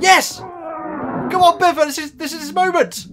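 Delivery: high-pitched, urgent rooting; excited cheering. Real, not acted. Urging Biffa on at the big moment, excited. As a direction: burst out cheering for a hero.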